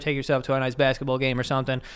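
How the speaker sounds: normal talking voice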